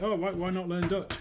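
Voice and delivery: loud, high-pitched imitated question; mock eager suggestion. Retelling the guy's question about learning Dutch, mock eager. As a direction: act out someone brightly urging an idea.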